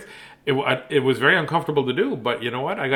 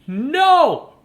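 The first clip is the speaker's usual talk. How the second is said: put-on high, loud child's shout; yelled no; 13 semitones higher, 6 dB louder than usual. A narrator's reading. Matthew refuses his dad, loud yell. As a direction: shout it out like a kid. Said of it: "yelled Matthew".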